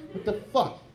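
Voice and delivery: ordinary talk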